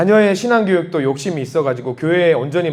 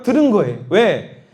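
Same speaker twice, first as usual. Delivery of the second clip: normal talking voice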